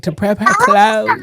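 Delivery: slow, drawn-out DC accent imitation. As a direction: mimic her DC accent, stretching each word. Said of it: "it's the D C accent".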